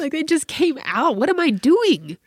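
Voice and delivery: high, loud comic dismay; flustered self-reproach. Real, not acted. Mom appalled at her own advice, loud and flustered. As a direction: cry out, aghast at yourself.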